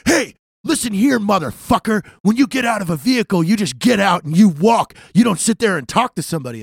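loud, high military dressing-down; irate first sergeant. Retold or imitated. Marine first sergeant scolding an airman on foot, irate. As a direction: imitate a sergeant chewing someone out. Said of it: "first sergeant. He goes".

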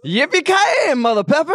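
high-pitched movie catchphrase cry; Bruce Willis. Acted. Guest showing hosts how to say the sauce name, action-hero catchphrase. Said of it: "say it like Bruce Willis".

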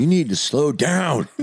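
mock Southern boss. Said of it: "She laughed".

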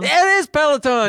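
loud, high, triumphant announcement; game show reveal. Pretend. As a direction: reveal it like a quiz host. Game-show style reveal of answer.

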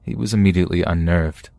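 ordinary delivery

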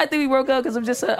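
ordinary talk

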